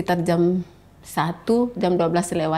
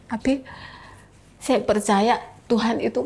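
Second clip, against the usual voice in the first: normal talking voice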